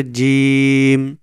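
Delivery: "জিম" with the vowel held four beats; long drawn letter. Shown as a demonstration. Teacher shows the four-alif stretch, "জিম" drawn out long. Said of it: "লম্বা করে চার আলিফ টেনে".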